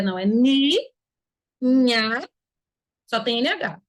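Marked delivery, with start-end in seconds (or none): 0.2-2.3 NI, NHA separated, I not nasal; pure I syllables. Shown as a demonstration. Teacher correcting the nasal reading, syllable by syllable. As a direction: say NI then NHA, apart and drawn out. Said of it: "o I tá puro".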